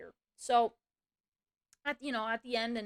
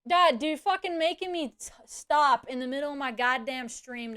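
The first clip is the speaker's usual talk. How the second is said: real irritation; 2 semitones higher, 5 dB louder than usual. Real, not acted. Streamer forced to stop and ban someone, pissed off. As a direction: snap in exasperation at a pest. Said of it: "you're pissing me off".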